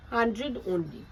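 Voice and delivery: ordinary talk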